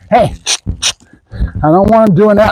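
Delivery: normal talking voice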